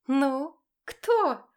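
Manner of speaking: high, loud, taunting challenge; predatory smile. A narrator's reading. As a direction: taunt her, grinning. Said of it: "с хищной улыбкой воскликнула женщина".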